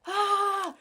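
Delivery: high, strained frustrated "ah"; frustrated cry. Retold or imitated. Remembering the wrong paper sizes, a high frustrated yelp. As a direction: let out a short exasperated yelp. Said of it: "I was like".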